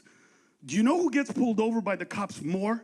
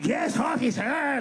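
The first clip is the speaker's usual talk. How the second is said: put-on Mexican accent, meek and eager; mock Mexican accent; slower than usual. Non-Mexican faking Mexican accent to officer, mock. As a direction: play a migrant faking a Mexican accent for the cop. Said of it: "sound like a Mexican".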